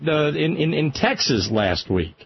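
normal talking voice

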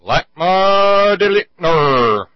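slow, loud alien proclamation; alien announcer. Pretend. Alien cyclops name proclaimed.

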